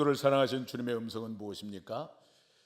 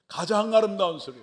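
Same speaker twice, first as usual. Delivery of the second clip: ringing exclamation; 8 semitones higher, 10 dB louder than usual. Acted. Preacher to the congregation, a loud exalted cry. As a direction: lift it high and loud, in awe.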